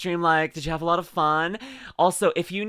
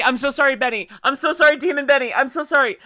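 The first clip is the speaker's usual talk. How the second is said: high, loud repeated apologies; flustered apology; 7 semitones higher, 7 dB louder than usual. Real, not acted. Streamer got a raider's name wrong, flustered apology. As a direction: plead loudly and high for forgiveness.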